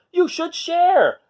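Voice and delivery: very high, slow little voice; scolding. A narrator's reading. Triceratops telling the Minosaur to share, high and slow. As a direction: chide slowly in a tiny high voice.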